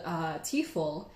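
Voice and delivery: ordinary talk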